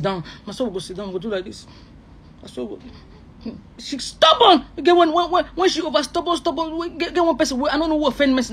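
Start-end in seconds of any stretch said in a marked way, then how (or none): 2.4-3.5 whisper. Acted. Fear of Mercy's power. 3.8-8.5 excited high rant. Real, not acted. Ranting that Mercy is stubborn.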